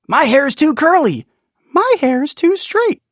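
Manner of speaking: put-on high, loud calling children; high kid voices. Townsfolk call down to Rumpel, high loud kid voices. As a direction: kids shouting their quirks, high and bright.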